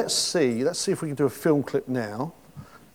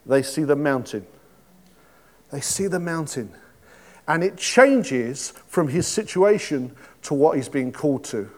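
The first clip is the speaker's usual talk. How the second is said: ordinary talk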